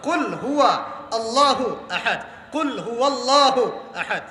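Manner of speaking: slow "কুল হুয়া আল্লাহু আহাদ", each word distinct, long vowels; words properly separated. Shown as a demonstration. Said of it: "কুল একটা শব্দ". ("কুল হুয়া আল্লাহু আহাদ" শব্দ আলাদা করে ধীরে তেলাওয়াত)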